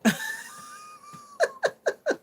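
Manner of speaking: squealing high-pitched laugh. Real, not acted. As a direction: break into a shrieking giggle. Bursts out laughing.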